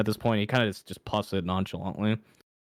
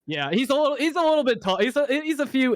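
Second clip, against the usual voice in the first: much higher, louder squeak; squeaky joke voice; 5 dB louder than usual. Acted. GM jokingly squeaks about height.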